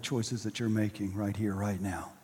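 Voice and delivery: soft sermon refrain. Acted. Preacher softly urging the congregation to choose. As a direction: lean in and press the point quietly.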